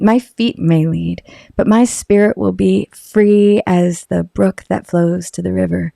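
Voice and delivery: low, slow, unhurried voiceover read of the ad. Shown as a demonstration. Asked by a friend to redo her Harley ad, low and slow. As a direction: draw each line out low and easy like a motorcycle commercial. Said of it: "can you do it".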